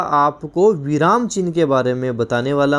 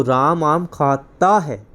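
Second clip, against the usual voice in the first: slow खाता, split as खा-ता है; very slow, खाता broken before ता; ending ता drawn out; much slower than usual. Shown as a demonstration. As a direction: stretch खाता to show the singular ता. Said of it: "देखो एक चीज़ ध्यान दो".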